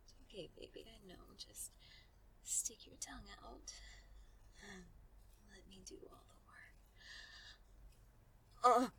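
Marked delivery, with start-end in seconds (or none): 0.0-6.8 whispering. Acted. Seductive instruction.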